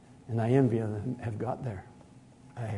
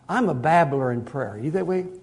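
ordinary talk, nothing marked

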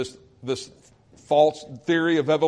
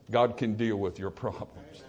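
ordinary talk, nothing marked

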